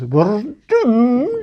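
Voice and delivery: loud, high Scottish cry of doom; Sergeant Fraser. Acted. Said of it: "It was Scottish, of course".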